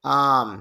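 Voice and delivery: আর্ম with a drawn-out aa, r left silent; long vowel, no r. Shown as a demonstration. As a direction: say আর্ম long, without any r sound. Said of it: "একটু দীর্ঘ হবে, র sound হবে না". (আর্ম, লম্বা আ, র উচ্চারণ ছাড়া)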